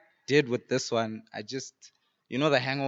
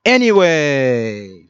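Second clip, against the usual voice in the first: drawn out; 3 semitones higher, 15 dB louder, much slower than usual. Acted. Drawn-out rap ending.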